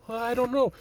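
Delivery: high, small mock-hesitant voice; put-on timid waverer; timid follower. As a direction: play the meek one being pushed around. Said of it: "timid people".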